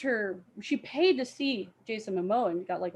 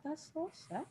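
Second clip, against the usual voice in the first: pitying aside; 6 semitones higher, 11 dB quieter than usual. Retold or imitated. Retold reaction to actor's trailer life.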